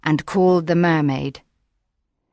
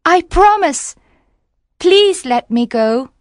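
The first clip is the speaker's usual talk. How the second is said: high, louder pleading; pleading mermaid; 11 semitones higher, 4 dB louder than usual. Acted. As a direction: beg urgently in a high voice. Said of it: "cried the mermaid".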